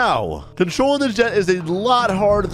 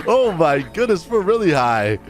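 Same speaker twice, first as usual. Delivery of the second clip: ordinary delivery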